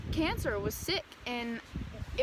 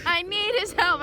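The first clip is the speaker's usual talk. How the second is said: louder pleading; 6 semitones higher, 8 dB louder than usual. Retold or imitated. Student begging the teacher to reseat her, louder, higher desperate plea. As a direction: repeat "I need his help" higher and louder, more desperate.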